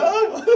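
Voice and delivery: high mock wail through laughter; imitated crying. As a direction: imitate a bawling child while laughing. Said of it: "they're looking like".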